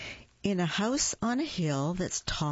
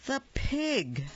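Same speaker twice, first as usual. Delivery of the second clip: high reveal; 9 semitones higher than usual. A narrator's reading. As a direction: jump up high on the pig. Dramatic reveal of next animal.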